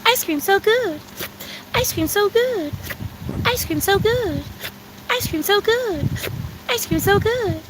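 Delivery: robotic sweet loop, a little slow; high NPC voice. Acted. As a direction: repeat it high and soft like a stiff NPC. Repeated NPC-style TikTok phrase.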